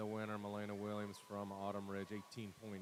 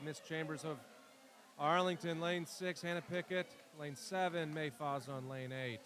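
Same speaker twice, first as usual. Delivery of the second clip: a hint of announcer, lightly raised, lane by lane; announcer read; 5 semitones higher, 7 dB louder than usual. Announcer reading the lineup to the pool, lightly raised. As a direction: announce each lane like a meet caller.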